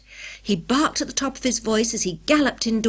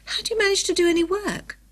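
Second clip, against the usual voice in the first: high-pitched incredulous question; astonished, pitch lifted right up; amazed; 10 semitones higher than usual. A narrator's reading. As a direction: ask it in baffled amazement.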